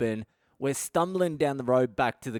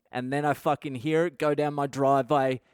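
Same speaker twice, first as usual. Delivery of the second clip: ordinary talk, nothing marked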